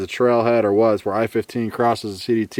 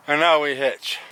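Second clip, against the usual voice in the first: ordinary talk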